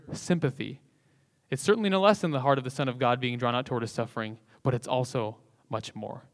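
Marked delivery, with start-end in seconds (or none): none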